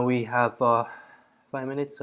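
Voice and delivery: ordinary delivery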